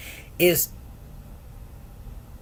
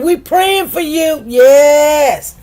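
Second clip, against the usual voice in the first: high, loud and slow call; loud slow praying shout; calling out blessing; 8 semitones higher, 7 dB louder, much slower than usual. Retold or imitated. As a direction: call it out like church encouragement. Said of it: "she said".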